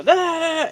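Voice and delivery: high, loud taunting da da da; mock babble. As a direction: drown them out with silly syllables, high and loud. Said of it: "I just go".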